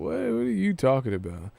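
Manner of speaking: ordinary delivery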